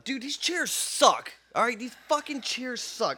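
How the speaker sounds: loud, high, drawn-out complaint; exasperated rant. Real, not acted. Ranting to co-host about chairs, loud and exasperated. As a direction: let real annoyance burst out, slow and loud.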